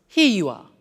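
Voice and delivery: "Here you are" run together quickly and smoothly; fluent. Shown as a demonstration. Teacher showing the fluent everyday form of the phrase. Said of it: "when we're speaking fluently".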